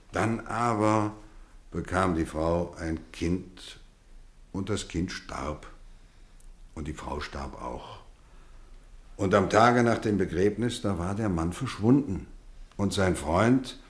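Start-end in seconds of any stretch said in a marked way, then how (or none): 0.1-8.0 quiet sadness. Acted. Teacher tells of a death.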